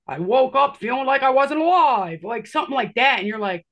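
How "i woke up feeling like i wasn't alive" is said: louder, put-on eerie message; masked man whispering clues, hammed up; cryptic stranger voice. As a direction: deliver it like a strange oracle.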